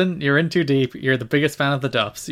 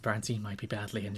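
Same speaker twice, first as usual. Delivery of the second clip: much softer, grave commentator hush; hushed tones; 4 semitones lower, 13 dB quieter than usual. Retold or imitated. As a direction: murmur it like a hushed golf commentator. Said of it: "hush tones".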